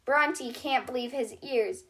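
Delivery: ordinary delivery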